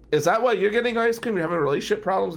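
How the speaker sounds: high, fast, pleading and tearful; teary question. Retold or imitated. Crying lawyer pressing the comic about ice cream, tearful and fast. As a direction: rush it out, half crying.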